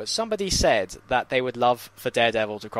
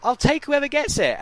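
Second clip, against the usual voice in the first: much higher-pitched, exasperated retort; 12 semitones higher, 4 dB louder than usual. Retold or imitated. Fan asked who should play the Doctor, exasperated. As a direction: throw it out high, as if fed up with the question. Retold exasperated answer about casting.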